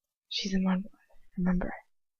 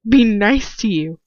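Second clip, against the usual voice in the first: slow, louder hissed retort; 3 semitones higher, 7 dB louder, slower than usual. A narrator's reading. Captive girl throwing his words back at him, hissed. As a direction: spit it back with slow contempt. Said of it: "I hiss".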